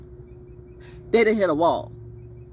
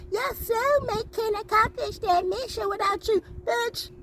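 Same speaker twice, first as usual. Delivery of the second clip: mocking voice. Mocking the soulmate idea.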